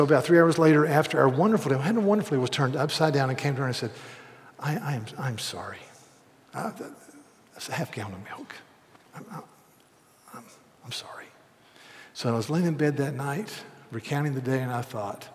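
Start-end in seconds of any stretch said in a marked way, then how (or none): 4.6-11.3 soft apology. Retold or imitated. Wife's hesitant apology retold.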